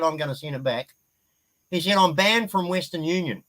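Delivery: normal talking voice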